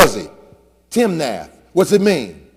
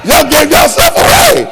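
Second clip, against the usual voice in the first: high, loud teasing call-out; preacher calling out the grinning folks, loud and high; teasing shout; 9 semitones higher, 5 dB louder than usual. Acted. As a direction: catch them out with a shout.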